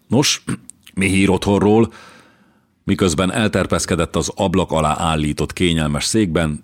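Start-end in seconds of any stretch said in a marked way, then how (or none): 0.1-1.9 higher, embarrassed change of subject; awkward throat-clearing. A narrator's reading. King breaking the silence to ask for news, ill at ease. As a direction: clear the throat uneasily, then ask brightly. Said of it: "feszélyezetten köhintett egyet".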